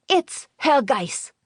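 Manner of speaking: put-on high, slow child voice; excited child. A narrator's reading. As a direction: announce it like a proud boy. Child tells Liesel.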